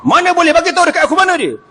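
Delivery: high, fast, pushy demand; insistent Umar. Acted. Umar refuses to accept Abu Darda's silence, insistent. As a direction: insist without pause. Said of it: "Omar tak boleh".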